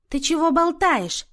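slow, high, loud rebuke; pouncing, indignant. A narrator's reading. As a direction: lash out at her nonsense. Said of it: "накинулась на неё Люся".

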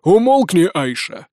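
gruff snap, loud then dropping; angry, put-on rough voice; snapped order. A narrator's reading. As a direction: bark it as a bandit chief. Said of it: "огрызнулся первый голос".

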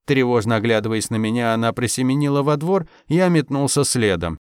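ordinary delivery

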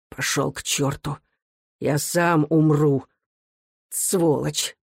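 slightly hoarse dying man's curse; understated hoarse rasp. A narrator's reading. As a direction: rasp it out wearily, with little force. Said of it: "прохрипел Михаил".

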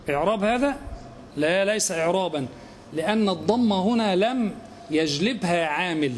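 usual speaking voice

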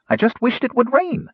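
high-pitched little gnome, insisting. A narrator's reading. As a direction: protest your innocence in a high gnome voice. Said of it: "Rainspot insisted".

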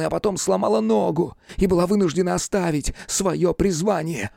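high, impassioned account; agitated telling. A narrator's reading. Hunchback telling investigator his beloved's misfortune, agitated. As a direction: press the story on with heated feeling.

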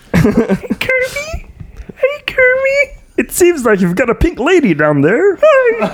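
very high squeaky cartoon voice. Squeaky Kirby-like voice.

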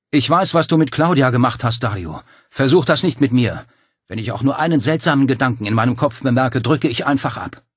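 hard threatening voice; threatening Paul. Acted. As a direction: warn him off at gunpoint.

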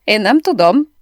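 loud laughing denial. A narrator's reading. Marita denying it to the narrator, laughing. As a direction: deny it through tears of laughter. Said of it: "nevetéstől könnyes szemmel".

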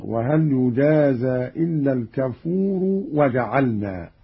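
الكفور ending in -u, run into وجعلنا; joined reading. Shown as a demonstration. Teacher shows the joined form, الكفور voweled with damma. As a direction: say al-kafooru, straight on into وجعلنا. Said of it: "وإذا وصل برفع الكفور".

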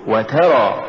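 وترى with و set apart and ترى pushed; push after waw. Shown as a demonstration. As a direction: lean on the ت and draw out وترى. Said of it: "قل". (نطق وترى بدفع التاء بعد الواو)

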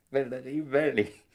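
high, loud cry of her name, rising in joy; joyful calling out. Acted. Lover calling his old love's name in joyful disbelief. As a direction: call her name out in delight.

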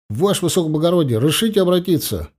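quick, snappy military address; orderly reporting to his commander, fast and formal; brisk soldier report. Acted. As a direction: rattle it off like an orderly.

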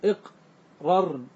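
"إقررن" slowly as iq... rarn, with a gap; syllable by syllable. Shown as a demonstration. Teacher showing the original form, "إقررن" slowly in parts. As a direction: split "إقررن" after the ق, slowly.

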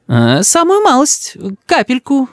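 high, hesitant backing down; anxious. A narrator's reading. Grandmother placates threatening grandson, alarmed. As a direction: shrink it nervously, up high. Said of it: "в голосе бабушки тревога".

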